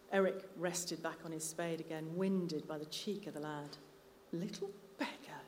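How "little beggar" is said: soft, grumbling "little beggar"; mutter. A narrator's reading. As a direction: grumble it under your breath. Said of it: "he muttered".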